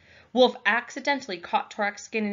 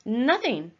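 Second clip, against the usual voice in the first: too quickly; 5 semitones higher, 6 dB louder than usual. Acted. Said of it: "too quickly".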